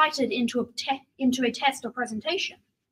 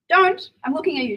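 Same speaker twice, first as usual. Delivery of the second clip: loud and higher, a stern single word; sharp warning; 3 semitones higher, 5 dB louder than usual. Real, not acted. Student warning the audience against weed, loud and stern. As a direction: bark it at the crowd.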